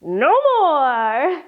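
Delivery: lightly voiced tired little mouse; a hint of a worn-out mouse. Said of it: "The mouse said".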